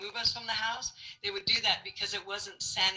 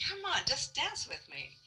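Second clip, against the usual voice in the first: lightly pleading, higher-pitched imitation; mild coaxing; 6 semitones higher, 4 dB quieter than usual. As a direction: coax playfully in a raised voice. Man coaxes her to dance.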